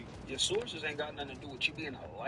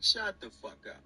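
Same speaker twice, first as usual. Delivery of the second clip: usual speaking voice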